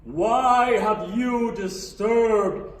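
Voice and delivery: loud, very slow, booming grandeur; high and mighty voice. Acted. God confronting the intruder in heaven, high and mighty. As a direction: thunder it out slowly like an almighty ruler. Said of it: "this big, high and mighty ass voice".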